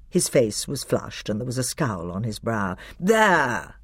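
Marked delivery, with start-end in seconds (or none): 3.0-3.7 short growl of annoyance. A narrator's reading. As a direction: bite the word out with a growl. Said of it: "he growled".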